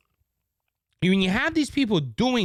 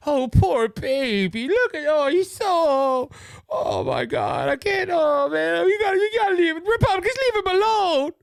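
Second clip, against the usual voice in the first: high, pitying mock baby talk, crying at the end; mock coddling; 7 semitones higher than usual. Mocking the media pitying Hunter, high cooing voice. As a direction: coo over him like a fussing parent.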